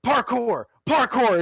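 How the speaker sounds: high mock yell of parkour. Youtuber running from the cave, mock yelling. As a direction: shout parkour while fleeing. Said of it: "he's, like, yelling parkour".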